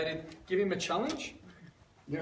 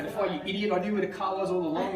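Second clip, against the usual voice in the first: mock exasperation; 4 semitones higher, 7 dB louder than usual. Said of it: "and then later on".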